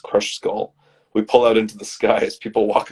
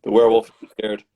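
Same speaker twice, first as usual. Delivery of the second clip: ordinary talk, nothing marked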